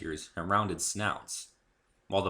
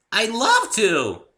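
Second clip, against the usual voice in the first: much higher, much louder, drawn-out squeal; 13 dB louder, much slower than usual. A narrator's reading. Pony thrilled to be Dave's tour guide, squealing. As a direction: squeal with pure delight. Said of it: "she squealed".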